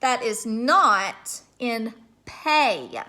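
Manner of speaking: "play" and "pay" drawn out slowly; very slow teacher question; slow, careful question. Shown as a demonstration. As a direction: ask it slowly so kids can hear each sound. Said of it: "tell me the sound that you hear".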